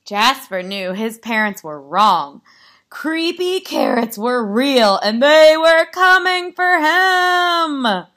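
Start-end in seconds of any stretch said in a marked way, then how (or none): 2.9-8.1 building to a high, loud, drawn-out cry; dramatic climax. A narrator's reading. Narrator reveals carrots coming for Jasper, dramatic. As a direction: swell into stretched-out alarm on the last phrase.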